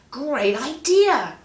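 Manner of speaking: high, slow, bright-eyed cheer; excited monkey voice. Acted. Monkey cheering as banana gets thrown, high and excited. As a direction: squeal it like a thrilled little monkey.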